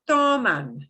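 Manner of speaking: toman with a strong, long TO, falling end; stress on first syllable. Shown as a demonstration. Teacher pointing out the second to last syllable, stressed TO in toman. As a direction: punch the TO in toman. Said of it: "Second to the last syllable is".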